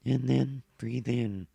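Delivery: slow, loud robot voice on an inward breath; inhale robot voice. Shown as a demonstration. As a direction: speak while inhaling like a robot. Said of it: "the inhale robot voice".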